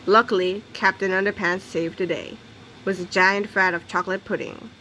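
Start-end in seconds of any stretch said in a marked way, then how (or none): none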